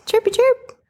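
high chirpy bird voice; parakeet chatter. Pretend. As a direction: chirp back playfully. Chirp imitation.

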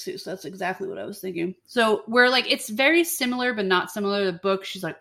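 ordinary talk

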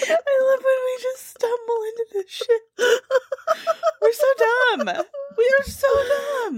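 very high, squealing through laughter; helpless laughing. Real, not acted. Host amused they stumbled into this, squealing with real laughter. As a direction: talk while cracking up. Host cracking up at own silliness.

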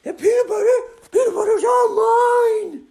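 very high, loud greedy shout; possessive girl dragon. Acted. Dragon guards her peanut butter, high possessive shout. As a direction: claim it at full volume in dragon voice. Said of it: "And she said".

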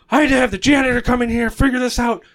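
high, fast angry shout; teacher yelling at the class; mock angry teacher. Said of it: "And he freaks out".

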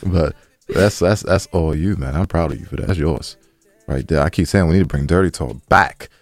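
ordinary delivery

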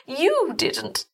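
high, feeble reproach; weakened woman. A narrator's reading. Weak wife reproaching her husband, high and feeble. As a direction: say it high, with little strength. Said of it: "She was weaker now".

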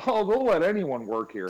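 high, loud jokey one-liner; mock self-deprecating quip. Imitating coworker's joke to each new colleague, hammed-up. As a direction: sell the corny joke hard. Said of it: "he just".

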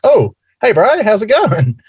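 high-pitched, delighted mock hello; imitating an eager, high hello; acted surprised greeting. Acting out surprise greeting over intercom.

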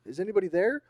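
loud, high call with rising end; calling into darkness. Acted. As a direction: shout a question down the hallway. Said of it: "And he's like".

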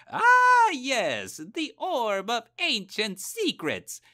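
very high, slow, loud cartoon wizard; a dotty old wizard savoring his discovery; high, drawn-out silly wizard exclaiming. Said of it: "pretending to be a wizard who's looking for a cookie recipe".

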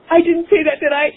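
gasp, then a high disbelieving line; horrified at her own words; shocked gasp. Retold or imitated. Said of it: "I couldn't believe".

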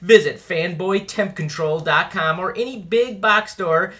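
upbeat commercial pitch; ad read. Acted. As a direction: sell it like a radio ad. Sponsor ad read.

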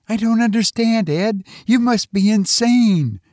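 high-pitched, disbelieving woman's voice; bewildered wife. Acted. Wife doubts her husband's sanity, bewildered and high. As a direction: protest quickly in a high, bewildered voice.

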